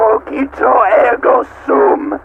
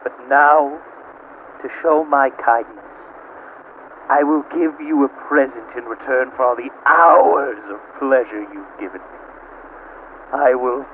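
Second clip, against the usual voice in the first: much lower and softer, silky threat; menacing hush; 8 semitones lower, 7 dB quieter than usual. Acted. AM offering Gorister false mercy in a low hush. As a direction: purr the cruelty quietly.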